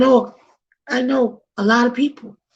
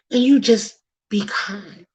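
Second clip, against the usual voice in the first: ordinary delivery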